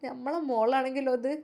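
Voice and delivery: soft, pleading mother's voice; pitiful plea. Acted. Mother pleads softly with her son to stay. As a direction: ask him softly, as if begging. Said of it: "ഉമ്മ ദയനീയമായി നോക്കി".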